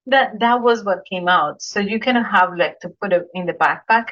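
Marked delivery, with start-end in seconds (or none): none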